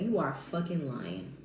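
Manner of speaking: ordinary talk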